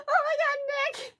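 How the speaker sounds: very high, laughing exclamation; real cracked-up delight; laughing squeal. Real, not acted. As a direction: squeal it through helpless laughter. Cracking up at Nick's return.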